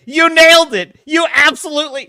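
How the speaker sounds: loud, high, thrilled; excited. Retold or imitated. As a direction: cheer him on. Excited telling uncle he's right.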